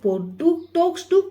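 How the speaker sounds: drawn-out question with a rising end; slow reading voice. Shown as a demonstration. Teacher reading the question slowly to the class. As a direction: read it out slowly for the children.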